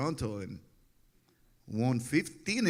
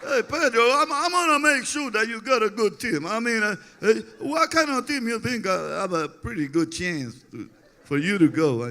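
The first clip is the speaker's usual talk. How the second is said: much higher, louder put-on manager voice; imitating Felipe; 10 semitones higher, 6 dB louder than usual. Pedro voicing manager Felipe asking where he'd go. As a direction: play the eager manager, high and loud. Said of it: "That's how he talks".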